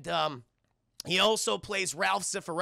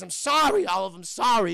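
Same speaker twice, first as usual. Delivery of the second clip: overwrought apology; 7 dB louder than usual. Retold or imitated. Stressed penguin apologises to Olive, loud and flustered.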